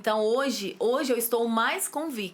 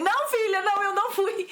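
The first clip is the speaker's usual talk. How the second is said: much higher and louder, giggly admission; sheepish confession; 10 semitones higher, 4 dB louder than usual. Retold or imitated. Mother confesses to daughter she didn't check, high and sheepish. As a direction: own up to the little lie, half laughing. Admitting her small lie to daughter.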